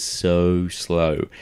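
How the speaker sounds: slow, lingering emphasis on "so slow"; drawn-out slowness. Shown as a demonstration. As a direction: stretch SO and SLOW right out. Mimicking the slowness of the scene.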